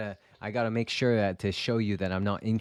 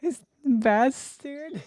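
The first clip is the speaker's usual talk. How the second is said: exasperated drawn-out curse; much slower than usual. Real, not acted. Amused outrage at smug villain.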